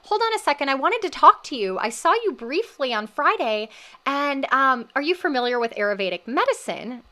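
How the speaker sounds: eager plant medicine woman. Retold or imitated. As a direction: call her back, eager to share.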